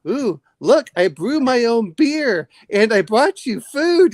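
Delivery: put-on high, slow coaxing old woman; wife offering homebrew, high and slow; old woman's voice. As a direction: coo it slowly like a granny. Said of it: "And she says".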